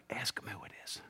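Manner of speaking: secretive whisper to a neighbour; hushed whisper. Acted. Simon Peter prompting John across the table, whispering. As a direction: whisper it low so the table can't hear. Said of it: "You don't want to scream it".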